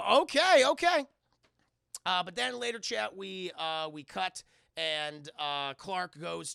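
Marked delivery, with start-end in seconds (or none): 0.1-1.1 high, very loud okay; startled mock yelp. Clark answering his friend's send-off, startled loud yelp. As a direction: blurt it out, overplaying the alarm.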